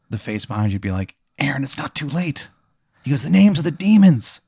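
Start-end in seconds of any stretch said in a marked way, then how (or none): 1.4-2.5 soft, higher-pitched eerie plea; sinister face. Acted. Face behind patient urging him, soft and eerie. 3.2-4.4 high, wavering eerie cry; eerie face. Acted. Face tempting patient with secrets, high and eerie.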